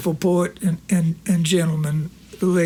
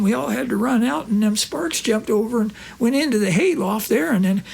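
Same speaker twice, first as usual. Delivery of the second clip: higher, lightly voiced old country friend; Corky's voice; 5 semitones higher than usual. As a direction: play the innocent old pal.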